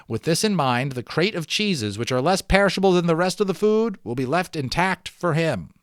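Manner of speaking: steady read-aloud storytelling voice; story narration. Acted. Teacher reading the balloon novel aloud to students, storytelling narration. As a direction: read it like a chapter book to the class.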